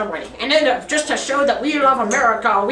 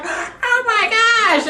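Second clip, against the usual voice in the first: much higher, very slow astonished cry; shocked kid gasp; 10 semitones higher, much slower than usual. Retold or imitated. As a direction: gape at it like a stunned little kid. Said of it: "just like".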